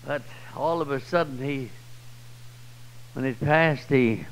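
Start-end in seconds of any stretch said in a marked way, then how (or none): none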